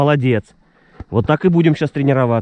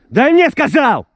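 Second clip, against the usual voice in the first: stern command; 4 dB louder than usual. Real, not acted. Dog training, demanding the retrieve.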